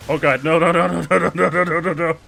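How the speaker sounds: loud, high panicked no's; terrified horror victim. Real, not acted. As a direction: scream no while laughing in panic. Fleeing the crab.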